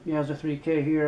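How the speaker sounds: loud, upbeat greeting to viewers; bright YouTube intro energy; chirpy haul video. Acted.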